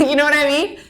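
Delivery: ordinary talk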